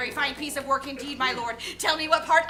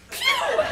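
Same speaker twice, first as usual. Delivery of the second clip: squeaky pew sound. Acted.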